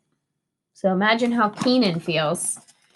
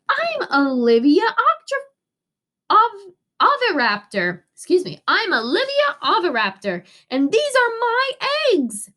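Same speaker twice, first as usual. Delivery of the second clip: Olivia voice; 8 semitones higher than usual. A narrator's reading. Olivia introduces herself.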